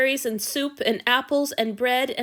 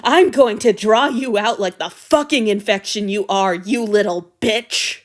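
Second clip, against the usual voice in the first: loud, vicious snarl; furious threat; 8 dB louder than usual. Acted. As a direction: spit the threat out.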